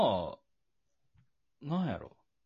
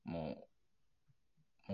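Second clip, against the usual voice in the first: ordinary delivery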